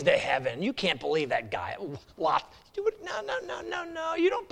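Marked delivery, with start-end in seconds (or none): 2.8-4.5 high, crying-out no no no; mock the believer with a wail; mocking devil protest. Devil scoffing at God's plan, high mocking cry.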